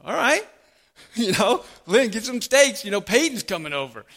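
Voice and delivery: high, loud, delighted outburst; can't contain the joy at the news; thrilled whoop. Pretend. Preacher pretends Peyton is coming, calling out to wife excitedly.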